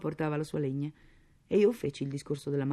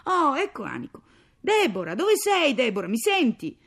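high, loud household call; 9 semitones higher, 10 dB louder than usual. Acted. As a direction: call to someone in another room. Father calls out to his wife. (richiamo ad alta voce)

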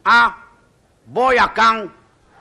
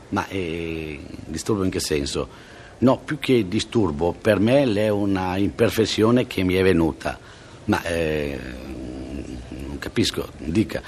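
doctor voice; 10 semitones lower, 3 dB quieter, slower than usual. Acted. Doctor puzzled by patient.

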